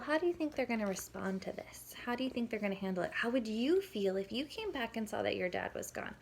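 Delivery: ordinary talk, nothing marked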